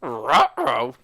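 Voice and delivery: loud, growly cartoon dog voice; Scooby-Doo voice. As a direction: say it like a cartoon Great Dane. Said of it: "that was the Scooby".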